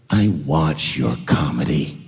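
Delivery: low, slow, ominous mock voice; mean lady. Mean lady confronting comic by his car, low ominous imitation.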